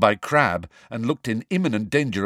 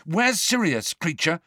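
demanding; 8 semitones higher than usual. A narrator's reading. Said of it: "Harry demanded".

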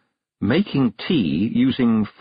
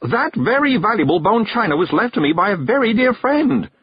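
higher, louder cry of alarm; alarmed shout; 12 semitones higher, 4 dB louder than usual. A narrator's reading. Miss Level protects her china, alarmed shout. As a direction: save the china with a yelp. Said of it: "shouted Miss Level".

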